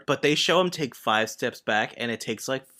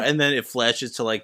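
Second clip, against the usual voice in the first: normal talking voice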